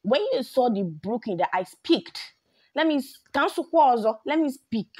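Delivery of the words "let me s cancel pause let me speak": higher and louder, heated; worked up. Real, not acted. Vlogger fumes at fake-accent critics, heated outburst. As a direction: stop herself mid-rant. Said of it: "Let's speak, [name], calm down".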